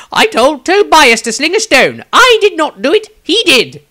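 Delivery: pouting. Acted. Wizard pouts and blames Tobias to Dieter, high and sulky. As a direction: pout and shift the blame like a sulky child. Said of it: "Maestro pouted".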